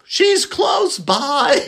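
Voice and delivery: high, slow eerie whisper-shout; creepy old lady voice. Retold or imitated. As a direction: stretch it out like a spooky grandmother. Nana Rose voiced on phone.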